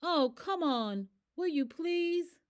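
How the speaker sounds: high, slow pleading; slow high begging plea. A narrator's reading. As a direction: beg the runaway ostrich to stop. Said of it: "so I started to beg".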